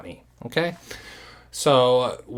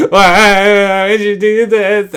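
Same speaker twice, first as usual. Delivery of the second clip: higher, louder, slow whine; mock whine; 8 semitones higher, 11 dB louder, slower than usual. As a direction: whine it pleadingly. Imitating her whining.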